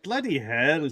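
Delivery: a broad Aussie "Bloody hell" with a laugh in it; Australian accent. Real, not acted. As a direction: let the home accent slip out, laughing. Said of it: "my accent comes out more".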